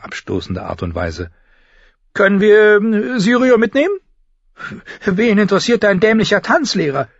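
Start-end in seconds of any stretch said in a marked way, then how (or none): 2.1-4.0 high, loud, halting request; hesitant girl. Acted. Arya asks to take Syrio along, hesitant. 5.0-7.1 put-on high, loud girl's angry retort; snapping. Sansa rounds on Arya about the teacher, snapping. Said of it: "fuhr Sansa sie an".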